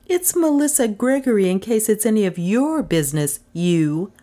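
high-pitched, indignant comeback; high, snippy woman character; tart woman's retort. A narrator's reading. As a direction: answer back tartly in a high voice. Acted tart retort to questioner.

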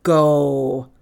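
loud, low Viking command; outraged cry. A narrator's reading. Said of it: "cried the Viking".

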